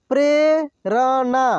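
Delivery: Prerna drawn out slowly, syllable by syllable; slow sounding out. Shown as a demonstration. Teacher slowly sounds out Prerna for students. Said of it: "एक एक मात्रा को अलग अलग करके".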